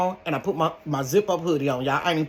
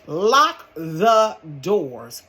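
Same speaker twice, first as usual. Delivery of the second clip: very slow, drawn out command; slow emphatic order; 4 dB louder, much slower than usual. Retold or imitated. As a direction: spell it out firmly. Repeated order, slowed.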